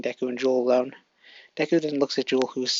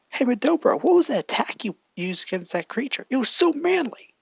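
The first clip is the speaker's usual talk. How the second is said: Kirishima voice; 10 semitones higher than usual. Acted. Kirishima praising Deku's attack, high eager voice. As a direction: gush about the manly attack, pitched up. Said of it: "Kirishima then says".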